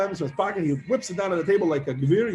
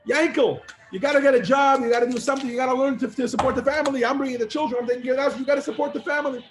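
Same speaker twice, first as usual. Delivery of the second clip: nagging wife; 6 semitones higher than usual. Retold or imitated. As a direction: push him to find work, lightly voiced. Wife nagging jobless husband.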